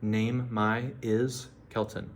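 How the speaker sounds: slow, word-by-word "name my is Kelton"; teacher slowly glossing each word; slow literal gloss. Shown as a demonstration.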